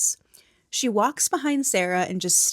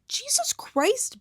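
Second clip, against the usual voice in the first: shocked exclamation; slower than usual. Retold or imitated. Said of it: "everyone is like".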